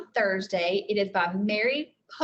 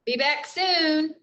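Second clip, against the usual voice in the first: calling out; 6 semitones higher, 3 dB louder, slower than usual. Acted. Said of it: "Jack called to their parents".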